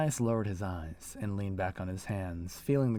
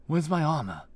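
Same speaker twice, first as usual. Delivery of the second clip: higher, louder alarmed question; 8 semitones higher, 6 dB louder than usual. Acted. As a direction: demand it in sudden alarm. Young mercenary's alarmed question.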